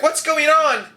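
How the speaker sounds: high, alarmed question; panicked young nurse. Acted. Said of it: "the young nurse looks there panicked".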